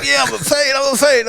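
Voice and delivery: high, hammed-up complaint of pain; put-on pain. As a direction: play up the pain, half laughing. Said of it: "I was putting it on more".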